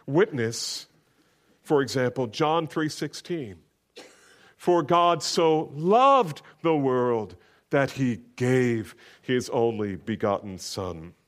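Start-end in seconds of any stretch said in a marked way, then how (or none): 4.6-11.1 slow, lifted scripture recital; solemn Bible reading from the pulpit. Acted. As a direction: read the verse with weight, stretching it out. Reads scripture aloud.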